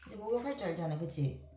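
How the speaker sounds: ordinary talk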